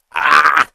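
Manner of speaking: loud, drawn-out, strained cry; strained Luigi yell. Retold or imitated. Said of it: "I just can't".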